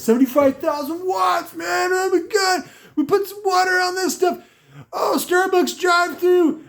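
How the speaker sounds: high, shouted, over-excited hype voice with cries; shrill mock hype-video shouting; mocking hype host. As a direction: ham up a gushing car audio showman. Mocking Steve Mead's hype video.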